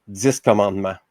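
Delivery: ordinary talk, nothing marked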